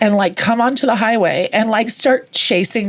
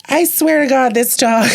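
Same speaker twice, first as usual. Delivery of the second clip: exasperated owner; 6 semitones higher than usual. Retold or imitated. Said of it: "she was like".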